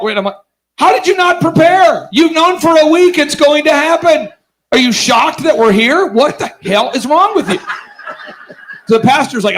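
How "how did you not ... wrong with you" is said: high-pitched incredulous rant, easing a little toward the end; exasperated scolding. Retold or imitated. Group leader berating unprepared Bible teacher, hammed-up exasperation. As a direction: let rip in comic disbelief.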